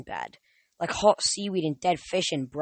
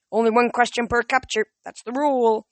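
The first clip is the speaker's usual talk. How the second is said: put-on stern old man; 6 semitones higher, 4 dB louder than usual. Nereus insists.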